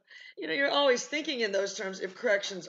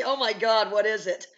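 loud reenacted jolt awake; startled alarm; 2 semitones higher, 10 dB louder than usual. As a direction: blurt it as if jolted from sleep.

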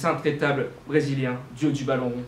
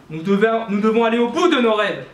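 élan exalté; 6 semitones higher, 6 dB louder than usual. Acted. Appel exalté à aller au bout des rêves.

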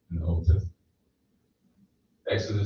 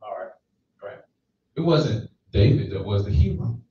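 usual speaking voice

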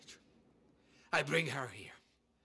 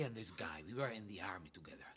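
softer, lower hushed whisper; 9 semitones lower, 8 dB quieter than usual. Acted. Boss confides about his old friend, hushed whisper. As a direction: lean in and breathe it.

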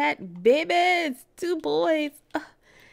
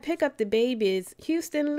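normal talking voice

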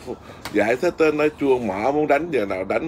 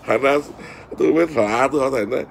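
normal talking voice

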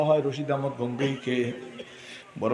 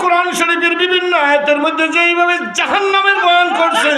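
melodic waz preaching; 13 semitones higher, 9 dB louder than usual. Acted. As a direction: preach in a sustained singing cry, stretching the phrase endings. (সুর করে উচ্চস্বরে ওয়াজ)

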